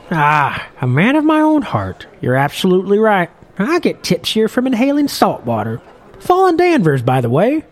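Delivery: put-on old fisherman. Old captain grumbling to Morwell at the bar, in a high loud old-man voice.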